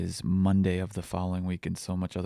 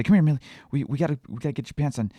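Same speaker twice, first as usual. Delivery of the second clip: harried parent; 6 semitones higher, 3 dB louder, faster than usual. Retold or imitated. Stressed father calling back his toddler.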